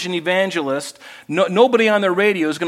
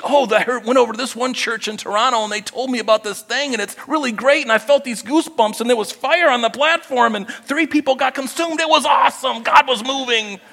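mock excited churchgoer; 8 semitones higher than usual. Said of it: "Don't be looking for new revelation".

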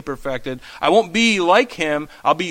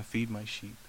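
much softer, lower, near a hush; soft command; 7 semitones lower, 15 dB quieter than usual. Acted. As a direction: say it quietly, like a tender charge. Jesus commissions Peter.

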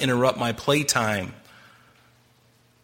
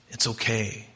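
much softer, tender comfort; gentle reassurance; 3 semitones lower, 7 dB quieter than usual. Pretend. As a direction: soothe someone lying at your feet. Jesus comforting prostrate believer.

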